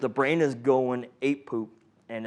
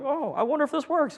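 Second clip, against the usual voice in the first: much higher, innocent-sounding musing; mock naive wonder; 12 semitones higher than usual. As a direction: play dumb about the dose. Medics musing over the dose.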